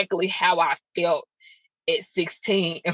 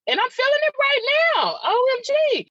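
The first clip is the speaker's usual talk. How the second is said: real surge of emotion, pitch leaping high; high, overwhelmed, close to crying; voice breaking. Real, not acted. Podcaster feeling the old hurt right now, voice breaking. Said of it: "hear my voice break a little bit".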